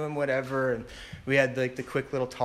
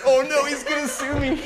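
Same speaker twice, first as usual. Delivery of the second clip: mock terror; 11 semitones higher, 7 dB louder than usual. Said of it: "And it was like".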